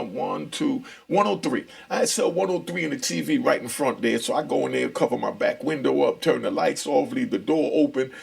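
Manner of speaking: ordinary delivery, nothing marked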